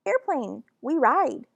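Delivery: put-on toddler demand. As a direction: demand it like a small child. Toddler demands airplane ride.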